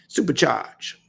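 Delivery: ordinary talk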